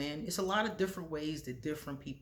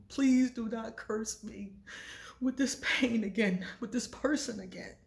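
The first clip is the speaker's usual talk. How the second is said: higher pleading; 6 semitones higher than usual. Retold or imitated. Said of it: "And I was like".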